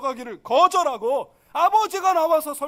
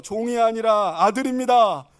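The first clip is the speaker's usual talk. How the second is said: loud, slow slogan for repeating; leading congregation; 7 dB louder than usual. Shown as a demonstration. Preacher leading the congregation in the title, declaiming. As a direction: lead the room in it, loudly. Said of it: "같이 하죠".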